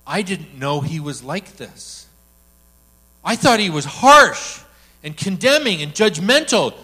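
ordinary talk

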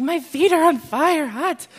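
retold cry of a woman whose feet burn; mock alarmed cry. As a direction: cry out like your feet are burning. Woman cries out feet burning.

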